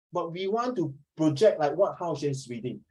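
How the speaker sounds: ordinary talk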